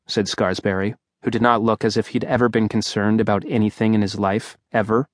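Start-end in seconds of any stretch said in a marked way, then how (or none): none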